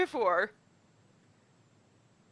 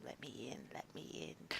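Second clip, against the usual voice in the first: hushed creepy plea; whispering; 12 semitones lower, 24 dB quieter than usual. Acted. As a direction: breathe it like a lurking thing. Creature trying to get in.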